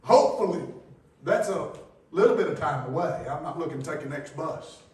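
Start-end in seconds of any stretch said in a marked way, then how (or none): 0.0-3.2 loud, high, ringing preacher's cry; shouting sermon in full flow; preaching at full force. Acted. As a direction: whip the congregation up from the pulpit.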